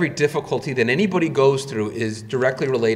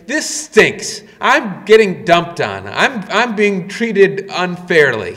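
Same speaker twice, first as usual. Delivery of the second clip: loud, high, drawn-out mock protest; indignant complaint; 4 semitones higher, 6 dB louder, slower than usual. Disciplined child complaining to parents, mock indignant. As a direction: stretch the key words in mock grievance.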